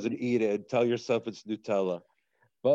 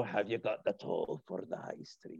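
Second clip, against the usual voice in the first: put-on Scottish accent; 2 semitones lower, 9 dB quieter than usual. A one-eyed toll keeper on the Royal Mile demanding a toll in a Scottish accent. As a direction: ask for the toll in a soft Scottish brogue. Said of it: "really good Scottish accent".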